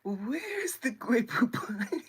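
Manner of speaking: loud, drawn-out mock refinement; snooty cultured kid. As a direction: ham up a cultured request, slowly. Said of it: "they gonna be looking ... you eat like".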